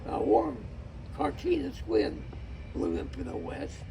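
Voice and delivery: ordinary delivery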